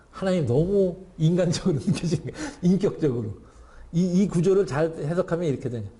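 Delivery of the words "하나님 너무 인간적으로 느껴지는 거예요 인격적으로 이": usual speaking voice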